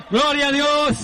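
fervent shout of praise. Acted. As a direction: lift the church with it. Preacher calls congregation to praise.

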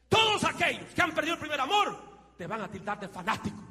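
high, loud preacher's cry; full-force preaching. Acted. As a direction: shout the call to stay on fire. (predicación a todo pulmón)

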